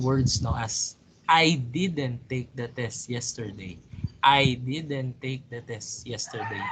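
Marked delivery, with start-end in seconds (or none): 1.2-3.8 "I" punched at the front, the rest falling away; stress on I. Shown as a demonstration. Teacher showing how stress changes meaning, stress on "I". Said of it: "putting the stress in I". 4.2-6.6 "I" set apart and stressed, rest unstressed. Shown as a demonstration. Teacher hinting somebody else took the test, stress on "I". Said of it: "if you're putting the stress on I".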